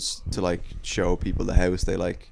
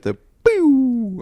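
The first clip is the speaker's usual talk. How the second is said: high, squeaky comic "pew" noise; Louis bit sound effect; 5 dB louder than usual. Retold or imitated. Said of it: "like the Louis bit".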